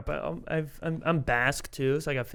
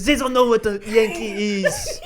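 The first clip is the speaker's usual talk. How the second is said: higher laughing mock French viewer voice; 5 semitones higher, 4 dB louder than usual. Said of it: "you got one French viewer ... It's like".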